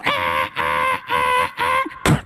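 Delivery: low droning beeps; alarm beeping. Retold or imitated. Imitating the alarm again.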